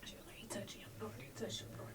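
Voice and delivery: hushed, breathy whisper of a terrible secret; whispered news. Retold or imitated. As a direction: whisper it like you can't bear to say it.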